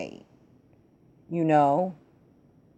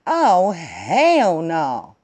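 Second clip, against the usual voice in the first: slow, louder, drawn-out hell no; drawn-out indignation; 5 dB louder, much slower than usual. Retold or imitated. To the dudes harassing Mamacita, slow indignant hell no. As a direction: let the outrage stretch out. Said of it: "And I'm like".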